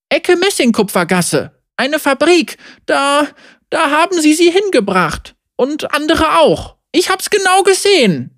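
put-on high-pitched, earnest child's voice; urgent young boy. Boy telling where his parents were taken, high and urgent.

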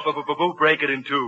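low, soft crooner hum. Retold or imitated. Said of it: "do that [name] imitation".

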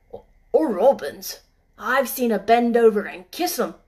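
put-on gruff old gardener, broad dialect; old Yorkshire gardener. Gardener Ben's voice.

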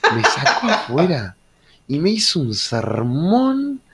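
ordinary talk

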